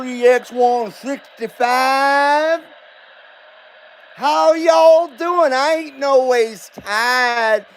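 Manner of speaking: put-on Southern accent, slow and sing-song. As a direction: play a folksy Southern good old boy. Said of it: "I was gonna do my show in an accent".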